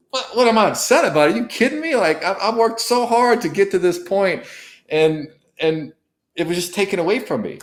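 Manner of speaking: high, indignant protest at God; reliving the outrage, voice shooting up high; retold angry outburst. Man protesting to God about his ruined day, indignant and high. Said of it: "I'm just angry".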